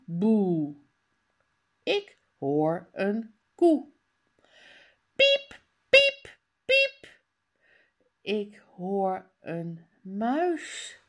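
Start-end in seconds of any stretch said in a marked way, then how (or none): 5.2-7.2 much higher squeaky piep piep piep; high little mouse voice; mouse squeak. Retold or imitated. Imitating a mouse.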